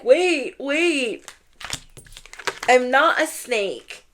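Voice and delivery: high, drawn-out mock plea; mock crying. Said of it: "Now they're crying".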